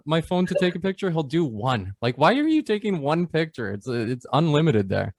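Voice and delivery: normal talking voice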